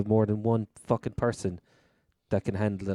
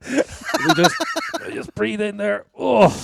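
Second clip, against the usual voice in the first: laughing; 12 semitones higher, 6 dB louder, slower than usual. Real, not acted. Joke about own bulk.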